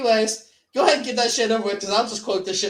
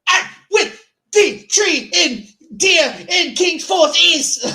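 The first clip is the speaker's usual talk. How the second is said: higher, louder, slow garbled imitation; mangled Sheriff line; 10 semitones higher, 5 dB louder, slower than usual. Quoting the Sheriff's garbled line, higher and louder. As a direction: ham up the Sheriff's twisted words. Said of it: "he would cut up".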